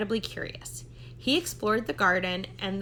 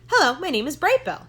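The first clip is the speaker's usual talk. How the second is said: eager little gosling; 6 semitones higher, 7 dB louder than usual. Acted. Gosling introduces himself.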